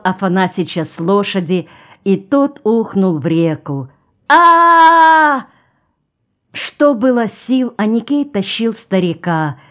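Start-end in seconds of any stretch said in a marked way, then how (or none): 4.3-5.5 high, loud drawn-out "aah" scream. Acted. Old man torn off his horse into the river, screaming. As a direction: cry out in one long, high yell.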